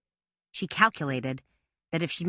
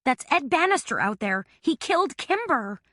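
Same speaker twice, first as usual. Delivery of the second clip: desperate; 9 semitones higher than usual. A narrator's reading. Desperate warning to Billy.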